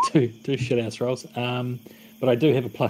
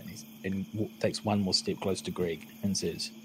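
ordinary talk

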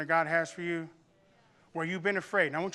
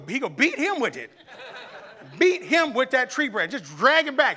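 exasperated mom; 6 semitones higher, 7 dB louder than usual. Retold or imitated. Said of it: "She goes".

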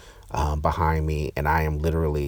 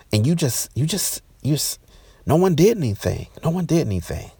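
very high, outraged accusation retold; mad; 10 semitones higher than usual. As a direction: accuse them all, voice climbing in exasperation. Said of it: "and I'm mad".

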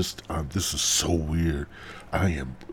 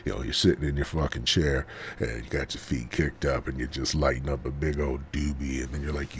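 normal talking voice

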